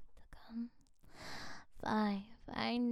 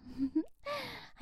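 whispered giggle; 11 semitones higher than usual. Real, not acted. Soft laugh after teasing.

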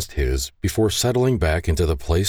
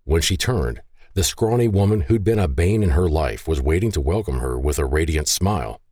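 ordinary delivery, nothing marked

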